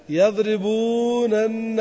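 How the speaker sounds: يضربونن said very slowly, each nun stretched out; drawn-out doubled nun. Shown as a demonstration. Teacher stretching the word so pupils count the nuns. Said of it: "الآن عندنا كم نونات؟".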